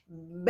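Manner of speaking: B said as a soft lip pop, no vowel after it. Shown as a demonstration. Teacher showing children the B sound as a little lip pop. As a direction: pop the lips for B like a tiny burst. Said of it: "Olha só como é que a gente fala bebê".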